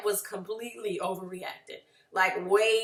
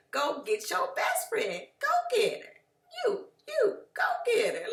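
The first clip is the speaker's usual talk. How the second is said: teasing singsong climbing much higher; mocking jab; 11 semitones higher than usual. Mocking a repeated best-friend jab.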